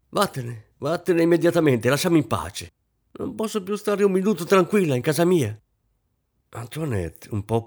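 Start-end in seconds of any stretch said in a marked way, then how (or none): 0.1-5.6 high, loud angry order to leave; mother ordering her daughter out, angry shout; angry dismissal. Acted. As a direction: drive her out of the room, furious. Mother driving daughter away.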